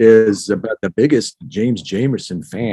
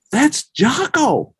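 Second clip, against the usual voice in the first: high, drawn-out cry of recognition; astonished exclamation; much slower than usual. Retold or imitated. Recalling telling a friend at the concert, astonished. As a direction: stretch it out, pitched way up in amazement.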